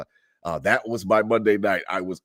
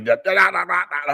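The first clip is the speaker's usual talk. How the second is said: mock nagging babble; 9 semitones higher, 4 dB louder than usual. Mocking ex-wife's ranting.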